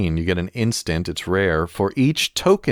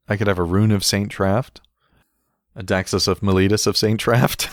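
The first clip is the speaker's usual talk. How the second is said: ordinary talk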